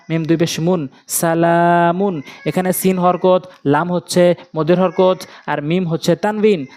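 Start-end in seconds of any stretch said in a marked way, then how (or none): 1.1-2.2 সালামুন slowly, লা held long; lam drawn out. Shown as a demonstration. Teacher applying madd in a word, lam drawn out. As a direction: stretch only the লা in সালামুন. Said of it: "শুধু এখানে লাম টান হবে".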